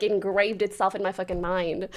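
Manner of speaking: ordinary talk, nothing marked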